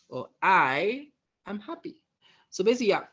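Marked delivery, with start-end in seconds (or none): 0.4-2.0 "I" high, loud and held, then a pause; stress on I. Shown as a demonstration. Coach demonstrating tone of voice, stress on "I". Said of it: "for example, I can say".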